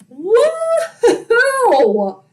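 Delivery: high, drawn-out woo-hoo; celebratory high holler; excited whoop. Real, not acted. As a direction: whoop loudly for the big play. Cheering a long launch downfield.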